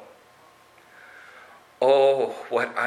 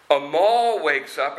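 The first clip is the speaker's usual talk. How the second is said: plain reading voice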